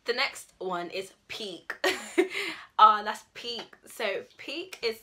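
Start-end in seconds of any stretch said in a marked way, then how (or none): 1.8-2.7 high, loud laughing burst; burst of laughter. Real, not acted. Laughing while introducing the word peak, loud burst. Laugh before slang example. 2.8-3.8 loud excited reaction. Acted. To a friend after a killer line, loud excited cheer.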